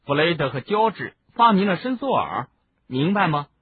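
high, eager explaining; hasty addition. A narrator's reading. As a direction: jump in quickly with the news. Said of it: "他赶紧补充道".

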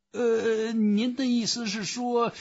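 very high, put-on clerk voice; high-voiced baffled official; droning phone clerk. A narrator's reading. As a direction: hesitate in a high, buzzing phone voice. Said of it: "电话里的声音在他耳中嗡嗡作响".

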